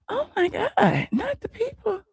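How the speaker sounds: very high, thin exclamation; playful dismay. Real, not acted. Streamer learns the story is everywhere, high playful dismay. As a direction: squeal it in mock horror.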